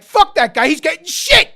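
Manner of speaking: loud, high-pitched rant against the player; fired-up outburst. Real, not acted. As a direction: blurt it out loud and high, fed up. Heated rant about rival player.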